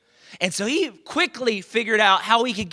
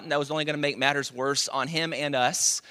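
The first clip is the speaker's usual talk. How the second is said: ordinary delivery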